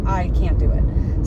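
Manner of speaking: normal talking voice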